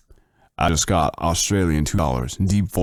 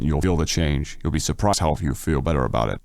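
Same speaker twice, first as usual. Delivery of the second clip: normal talking voice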